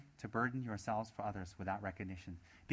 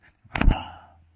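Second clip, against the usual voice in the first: loud, hammed-up gasp of fright; sharp mock gasp of dread; scared gasp; 19 dB louder than usual. Teacher mimics people asked to take on others' problems, exaggerated scared gasp. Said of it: "They're scared".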